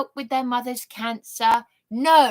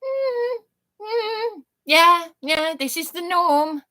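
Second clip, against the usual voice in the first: much higher, breezy mock shrug of yeah yeah; mock casual agreement; 7 semitones higher, 3 dB louder, slower than usual. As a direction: play it off as totally normal. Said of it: "And Mum and I were like".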